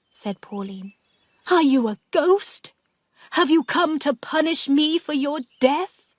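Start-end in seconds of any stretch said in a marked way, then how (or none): 1.5-6.1 higher, louder, fearful question; terrified of the ghost; frightened. Acted.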